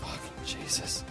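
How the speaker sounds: ordinary talk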